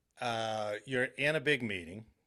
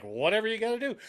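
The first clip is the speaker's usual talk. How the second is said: high eager voice; 10 semitones higher, faster than usual. Retold or imitated. Voicing eager Air Force brass.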